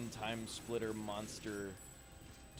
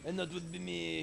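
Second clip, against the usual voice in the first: put-on French accent; 6 semitones higher than usual. Repeats the French accent bit.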